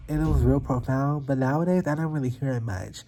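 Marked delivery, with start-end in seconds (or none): none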